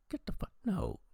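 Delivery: high-pitched, disbelieving protest; incredulous outburst. Retold or imitated. Reviewer recalling his reaction to Chad grabbing another, high incredulous protest. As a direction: blurt it out in shock.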